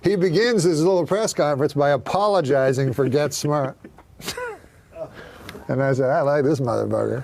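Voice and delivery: loud comic rant; a big loud stand-up rant. Retold or imitated. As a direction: bellow the story at the crowd.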